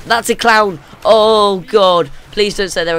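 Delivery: loud, high yelp of dread; stretched, loud dismay; horrified outcry. Real, not acted. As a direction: cry out at a hated sight. Player spots a dreaded clown.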